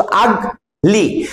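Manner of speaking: "আগলি" slowly as আগ, pause, লি; split into syllables. Shown as a demonstration. Teacher checking pupils understand, word divided in two. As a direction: break "ugly" in two with a clear gap. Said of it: "তা যাকেই ভাঙা যায়".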